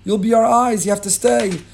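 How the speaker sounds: high, loud, slow "no, don't go"; insistent plea. Acted. Moshe insisting his father-in-law stay, high and slow. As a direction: double down and beg, stretching it out. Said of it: "Moshe doubles down".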